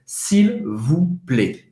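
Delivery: s'il vous plaît said slowly and evenly; slow, careful s'il vous plaît. Shown as a demonstration. Teacher stressing polite phrase, slowly spelled out for students. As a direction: take your time on s'il vous plaît. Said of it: "Muito importante".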